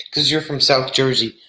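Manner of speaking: usual speaking voice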